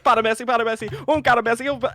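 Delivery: high, loud Spanish commentator call; excited commentator. Retold or imitated. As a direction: hype the run like a caster. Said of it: "he's like".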